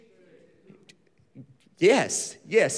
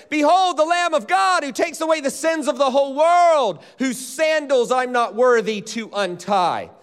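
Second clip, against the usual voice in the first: proclaiming; 6 semitones higher, 4 dB louder than usual. Retold or imitated. Quoting John the Baptist proclaiming.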